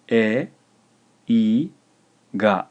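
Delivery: e, i and ga split by pauses; slow, emphatic dictation with gaps; syllable by syllable. Shown as a demonstration. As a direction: dictate e, i, ga one clear syllable at a time. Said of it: "This is three syllables".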